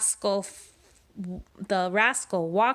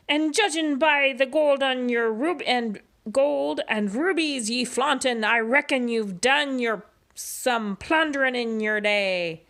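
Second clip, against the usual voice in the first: gruff pirate captain speechifying, a bit higher; 5 semitones higher than usual. Acted. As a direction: preach the pirate code like a swaggering captain. Pete's pirate voice.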